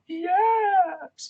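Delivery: very high, loud drawn-out scream; imitating wild yell. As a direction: belt out a mimicked victory cry. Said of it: "Flipping Dino's like".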